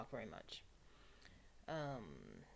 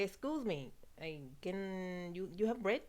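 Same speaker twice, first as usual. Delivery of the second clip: put-on Spanish accent; 3 semitones higher, slower than usual. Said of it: "I just speak English in Spanish accent".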